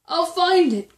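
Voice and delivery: high, loud determined cry. A narrator's reading. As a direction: vow it at the top of your voice. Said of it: "cried Horton".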